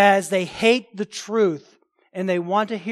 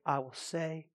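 hushed prophet; 5 semitones lower, 15 dB quieter than usual. Retold or imitated. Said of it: "Micaiah said".

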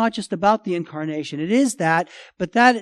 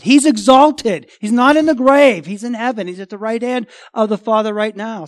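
full-force preaching; 5 semitones higher, 11 dB louder than usual. Acted. Pastor proclaiming the risen Christ to his church, loud and triumphant. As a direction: ring it out in triumph.

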